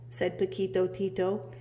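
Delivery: plain reading voice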